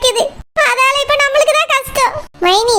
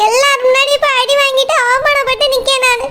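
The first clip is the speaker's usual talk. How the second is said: normal talking voice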